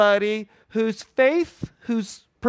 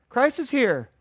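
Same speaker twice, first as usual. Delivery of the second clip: ordinary delivery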